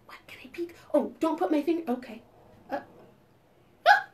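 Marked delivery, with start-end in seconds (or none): 0.1-0.7 whisper. Acted. Peeking secretly.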